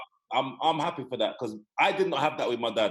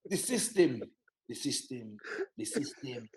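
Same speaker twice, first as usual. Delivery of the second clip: fading repeated grumble, dropping much softer; mock dad refrain; 3 semitones lower, 6 dB quieter, slower than usual. Son imitating dad's daily complaint, softly fading. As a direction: let it trail off like a weary mantra. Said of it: "that's what he's, man, tell me every morning".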